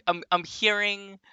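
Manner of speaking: ordinary talk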